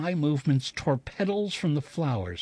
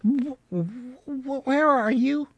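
put-on high, stammering little-girl whimper; frightened whimper; 10 semitones higher, much slower than usual. A narrator's reading. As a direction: whimper it out like a scared child. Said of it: "she hugged the elephant to her breast defensively and whimpered".

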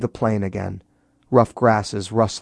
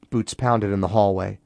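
ordinary delivery

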